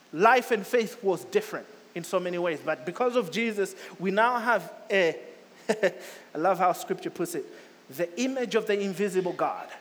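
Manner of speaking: normal talking voice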